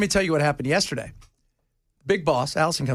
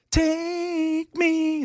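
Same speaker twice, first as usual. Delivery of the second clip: high rock wail; 11 semitones higher than usual. Retold or imitated. Singing rock high note.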